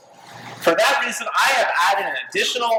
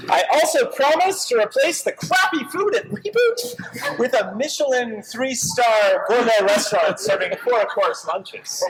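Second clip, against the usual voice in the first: ordinary delivery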